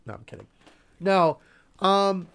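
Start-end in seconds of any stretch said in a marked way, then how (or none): 0.1-0.5 breathy whisper. Real, not acted. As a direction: whisper it under your breath. Joking aside about sexy nurses.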